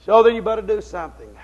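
loud preacher's warning, starting hard; forceful exhortation. Real, not acted. Preacher warning listeners after the Noah story, loud exhortation. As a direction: push it out loudly to the congregation.

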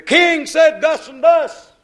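high, loud exclamation; loud burst. Real, not acted. Preacher telling of the king's message, loud high burst.

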